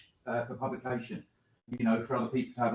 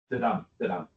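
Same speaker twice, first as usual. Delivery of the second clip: Da-dum tapped out lower, weak da then strong dum; iambic rhythm demo; 4 semitones lower than usual. Shown as a demonstration. Teacher showing students the iambic beat, stress on dum. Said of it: "that kind of thing".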